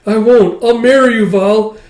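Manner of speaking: high, loud excited pledge; a lover proposing to Val, high and loud; eager proposal. Acted. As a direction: blurt the promise out eagerly.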